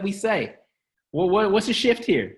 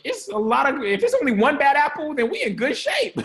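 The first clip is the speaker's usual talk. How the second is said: excited; 8 semitones higher than usual. Real, not acted. Excited point about apples.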